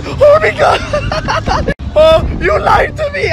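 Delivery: high, shaky scream of fear on a ride. Real, not acted. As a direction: scream as the ride flips upside down.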